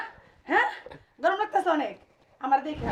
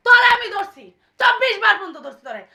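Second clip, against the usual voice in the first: louder, near crying as she accuses; tearful angry shout; 2 semitones higher, 6 dB louder than usual. Acted. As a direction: hurl the accusation through tears. Wife accuses husband, crying out.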